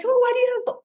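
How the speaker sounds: high, sudden startled question, cut off; retold startled blurt, broken off; startled gasp. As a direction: catch sight of the blood and blurt it. Retold shock at blood on foot.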